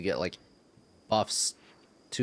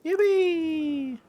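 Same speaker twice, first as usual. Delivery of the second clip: high mock cheer; 5 dB louder than usual.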